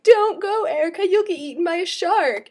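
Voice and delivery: high, loud pleading warning; playful panicked plea to a surfing friend; mock warning. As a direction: beg her not to go, hammed up. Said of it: "Rosie and I were like".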